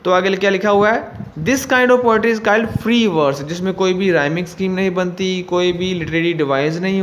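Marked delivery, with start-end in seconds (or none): 1.4-3.4 slow, careful reading of the instruction; slow textbook reading. Acted. Teacher reading the book to students, slow and careful. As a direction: read it out slowly for the class. Reads textbook line aloud.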